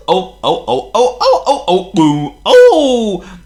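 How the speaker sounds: high, loud squealing truck voices; screeching trucks. Acted. Trucks screeching as Thomas pulls them. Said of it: "they screeched".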